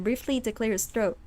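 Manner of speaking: normal talking voice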